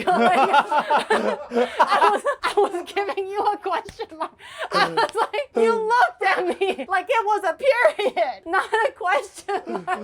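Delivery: laughing quote. Retold or imitated. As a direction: giggle out the boyfriend's words.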